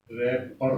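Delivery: रैपर said low and soft, w silent; teacher murmurs रैपर; soft model. Shown as a demonstration. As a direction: quietly say रैपर with no w. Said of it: "इसके exact correct".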